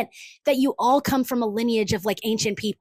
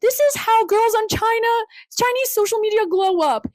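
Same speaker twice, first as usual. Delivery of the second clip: high-pitched parody presenter voice; mock influencer; 8 semitones higher than usual. Influencer introducing social media glow-up, high mocking voice. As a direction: mimic a glow-up video intro. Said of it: "they're always talking about".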